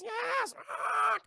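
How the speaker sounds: very high, squawking parrot voice; talking parrot. Retold or imitated. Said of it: "trained the parrot to talk for him".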